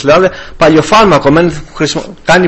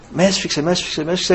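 hushed awe; 9 dB quieter, faster than usual. Retold or imitated. Reliving being embraced by Christ.

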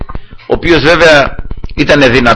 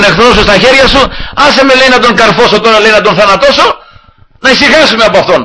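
eager urging; 7 semitones higher than usual. Retold or imitated. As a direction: push hard to be allowed to kill the king. Warrior urges David to kill Saul.

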